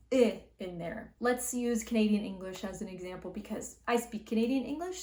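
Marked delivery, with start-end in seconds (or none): 0.1-0.4 "i" said as a short lax ɪ, louder; short i sound. Shown as a demonstration. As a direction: clip the vowel "i" short. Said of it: "We got this".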